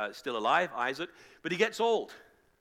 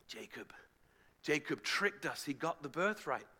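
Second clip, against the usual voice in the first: hushed, sinking realization; dawning dismay; 3 semitones lower, 6 dB quieter than usual. Acted. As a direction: murmur it as the deception sinks in. Isaac realizes he was tricked.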